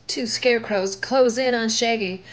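usual speaking voice

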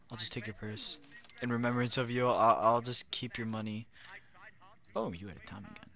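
ordinary delivery

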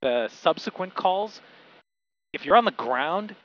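usual speaking voice

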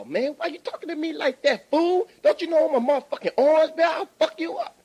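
high, loud threat to the owner; angry shout. Acted. Customer threatening the owner through the window, angry high shout. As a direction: bark it like a furious customer.

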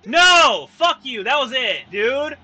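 loud outraged protest; angry gamer shouting; pissed off. Real, not acted. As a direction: curse the game out at full voice. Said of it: "I'm so pissed off right now".

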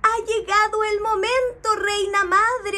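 high, loud joyful cry; excited. Acted. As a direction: burst out with delight. Bee excited, sun arrives.

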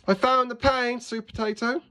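high, perky little cartoon voice; high-pitched, eager storybook helper; excited cucumber character. A narrator's reading. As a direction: pipe up proudly with good news. Cucumber excitedly reports finding paint.

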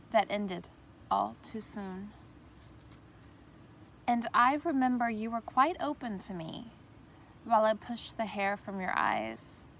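measured love-poem reading; poetry reading. Acted. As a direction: recite it earnestly, pausing after each phrase. Recites her second poem.

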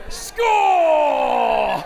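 loud, high victory yell; triumphant shout. Acted. Comedian cheering his imaginary win, triumphant shout. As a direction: celebrate like you scored a goal. Celebrating the zinger.

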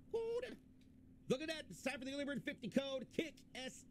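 high, excited announcing; excited shout. Real, not acted. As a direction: call out the code with a sudden squeal. Excited announcing a giveaway code.

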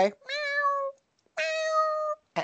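high soft kitten meows; cat meow. Retold or imitated. Walking past the cat and meowing, high and soft. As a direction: mew softly up high at the cat. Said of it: "I walk past the cat and I go".

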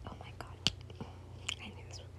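barely audible whisper. Real, not acted. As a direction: mutter it to yourself. Reader's whispered aside.

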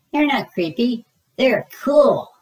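put-on high, insistent kid voice; a little bunny insisting he's grown; child protesting.

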